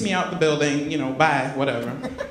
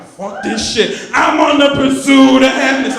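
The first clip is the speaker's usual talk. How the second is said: a loud, liberated shout with the pitch flying up; joyful yell; 7 semitones higher, 8 dB louder than usual. Retold or imitated. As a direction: belt it out like you just got free. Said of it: "singing the song as loud as I can".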